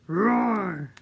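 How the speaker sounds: big loud roar; lion roar. Pretend. Teacher roaring for the kids in the song. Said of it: "with a roar".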